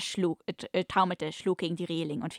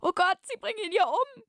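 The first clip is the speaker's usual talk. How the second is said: much higher, louder hammed-up damsel cry; frightened; 4 dB louder than usual. Bound young woman fears for the captain, high frightened cry. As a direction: shriek it like a frightened lady in a melodrama. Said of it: "In ihrer Stimme lag Furcht".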